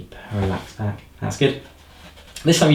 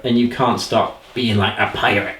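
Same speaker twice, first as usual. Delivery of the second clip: ordinary delivery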